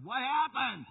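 high, loud startled question; alarmed shout. Retold or imitated. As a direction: blurt it out as a startled yell. Alarmed driver, retold.

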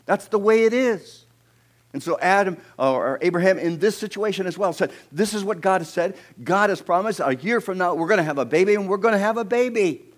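loud, high, emphatic preacher; impassioned preaching. Acted. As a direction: preach it with rising conviction. Preaching at full force.

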